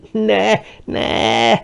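high, wailing plea; crying out. A narrator's reading. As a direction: wail in tearful protest. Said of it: "sírta közben Fábio". (síró, magas hangú könyörgés)

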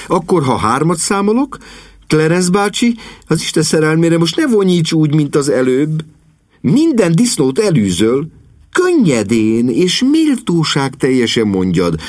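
put-on high, eager girl's voice; rally the helpers in a high, lively voice; spirited young niece. A narrator's reading. Niece urging uncle and butler to retry, spirited.

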